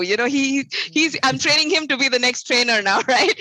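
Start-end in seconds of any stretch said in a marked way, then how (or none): none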